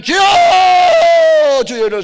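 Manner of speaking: loud, high prayer-meeting shout; 주여 외치기. Acted. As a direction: call out the verse and cry to the Lord at full volume. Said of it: "세 번 외쳤으니". (크고 높게 부르짖는 통성기도)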